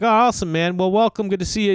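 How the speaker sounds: high, upbeat small talk; imitated chummy welcome; neighborly welcome. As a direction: pitch it up, mock-hearty welcome.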